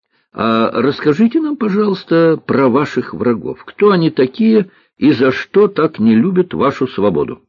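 put-on high, eager girlish voice; curious little girl. Girl asks cook about enemies, politely curious.

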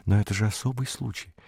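soft mumbled objection; muttering. A narrator's reading. Beatrice keeps on about her special case, muttering. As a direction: mumble it softly, half to yourself. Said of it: "продолжала бормотать Беатриса".

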